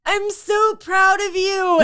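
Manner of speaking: high, slow, drawn out; proud gushing. Retold or imitated. Exhausted racer telling her friend she's proud. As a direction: gush it with pride. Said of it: "I'm like".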